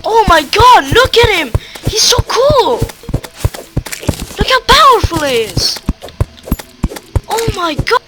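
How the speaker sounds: high, loud, emotional gushing; overjoyed, near tears. Real, not acted. Gamer shows off the new slime, overwhelmed with delight. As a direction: gush as if overwhelmed with joy. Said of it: "Look, I'm already crying".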